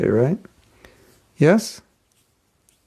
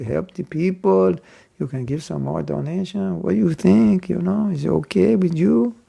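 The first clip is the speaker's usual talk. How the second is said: hammed-up Mexican-accented English, raised and pleading; 3 semitones higher, slower than usual. Poor monk asking a passer-by for more donation, Mexican accent. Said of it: "eh, good Mexican accent".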